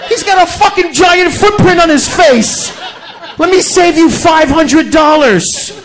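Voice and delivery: slow, high, shouted "He's got a fucking giant footprint"; slow, laughing mock outrage at the autopsy; mock shouted retort. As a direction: shout it slowly at the coroner, laughing. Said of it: "I'm like".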